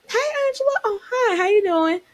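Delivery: high, sugary polite hello; sweet fake greeting. Cordial greeting acted out.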